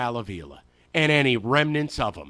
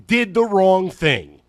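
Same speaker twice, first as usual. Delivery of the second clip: Did the wrong thing, slow, loud and deliberate; slow emphasis; 2 semitones higher, 8 dB louder, slower than usual. Real, not acted. Tombstone epitaph read slowly.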